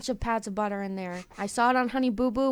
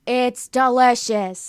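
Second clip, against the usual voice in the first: savoring; 2 semitones higher, 11 dB louder, much slower than usual. Real, not acted. Savouring food.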